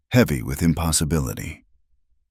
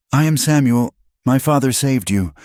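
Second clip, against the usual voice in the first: put-on young boy voice; 9 semitones higher, 4 dB louder than usual.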